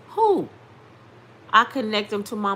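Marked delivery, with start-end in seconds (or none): 0.1-0.5 high, loud one-word question; startled auntie. Retold or imitated. Auntie on conference call asking her niece, startled and high. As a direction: pipe it up sharply, imitating a baffled relative.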